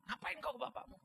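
high, loud mock scolding; imitated exasperated mother. Mother telling her son to leave his father be, exasperated. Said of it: "Mama saya karena jengkelnya pernah dia bilang".

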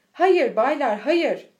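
loud, firm objection; sharp rebuke. A narrator's reading. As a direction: cut in loudly to forbid it. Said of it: "diye çıkıştı". (yüksek sesle çıkışarak)

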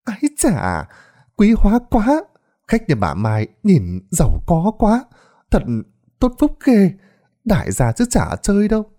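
high, admiring village gossip voice; fawning neighbor exclaiming; gushing neighbors. Acted. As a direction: coo over the rich guests. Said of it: "liền suýt xoa".

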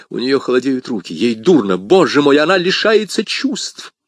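building to a high, loud cry; mounting alarm. Acted. D'Artagnan sees her fainting, mounting panic. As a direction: panic growing with each phrase.

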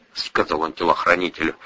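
usual speaking voice

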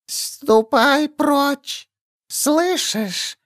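high, very slow thin hiss of a creature; thin whistling voice. A narrator's reading. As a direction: stretch the words out, thin and whistling. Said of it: "Голос ... тоненький, свистящий".

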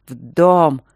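loud furious command; a sharp, furious hissed order. A narrator's reading. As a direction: bark it out in fury. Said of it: "свистяще и яростно повторил он".

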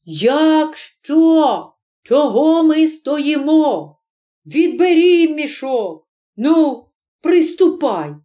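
high, shouted urging; high, drawn-out calls to action; urgent rallying call. Acted. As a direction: rouse a hesitant crony to act. Rallying crony to seize the sack.

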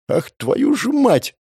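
high slow drawn-out curse; exasperated curse. Acted. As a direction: groan it out in disbelief. Hero realizes clock disaster. (отчаянное ругательство, протяжно)

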